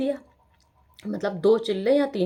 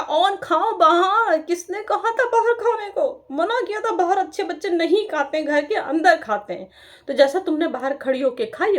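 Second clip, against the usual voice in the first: scolding mother; 10 semitones higher, 4 dB louder than usual. Retold or imitated. Mother scolding her daughter for eating outside, high and loud. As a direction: tell the child off sharply.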